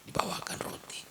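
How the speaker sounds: breathy whispered aside. A narrator's reading. Preacher whispering an aside to the audience. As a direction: drop to a secret whisper.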